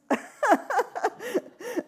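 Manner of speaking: high burst of laughter; a real, amused laugh. Real, not acted. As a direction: laugh at your own joke. Laughs at shepherdess joke.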